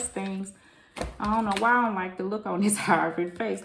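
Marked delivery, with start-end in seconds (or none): 1.2-3.6 laughing. Real, not acted. Laughs at the card's face.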